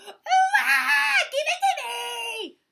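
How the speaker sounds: high, loud mock-tantrum wail; screaming. A narrator's reading. The animals copy Turtle's tantrum, screaming. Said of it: "They all started to scream".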